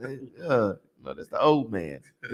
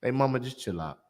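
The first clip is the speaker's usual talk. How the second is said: soft gentle; 11 semitones lower, 7 dB quieter than usual. Retold or imitated. Gentle version after learning.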